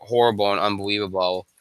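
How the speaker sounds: usual speaking voice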